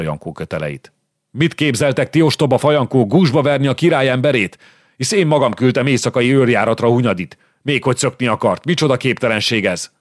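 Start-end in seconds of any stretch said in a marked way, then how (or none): 1.3-9.9 higher, louder outraged scolding of fools; officer scolding guards, higher and louder in outrage; indignant rebuke. Acted. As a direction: tear into the guards for their stupidity. Officer berates guards freeing prisoner.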